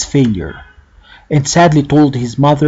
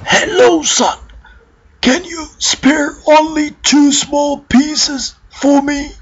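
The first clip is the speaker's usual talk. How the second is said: put-on much higher, frail old man's voice, with a cough; weak old man voice; 12 semitones higher than usual. A narrator's reading. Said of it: "a weak voice was heard".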